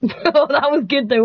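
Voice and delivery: ordinary talk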